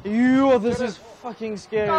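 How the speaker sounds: ordinary delivery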